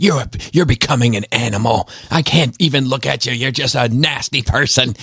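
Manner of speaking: lightly voiced grandma, mildly disgusted. Grandma telling her grandson he's become an animal, mild disgust.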